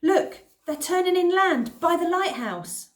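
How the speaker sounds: high, loud warning shout. Acted. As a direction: shout it out to the street. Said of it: "Someone shouted".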